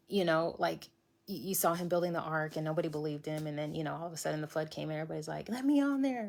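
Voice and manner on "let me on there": panicked plea. Retold or imitated. Panicked crowd at the flood.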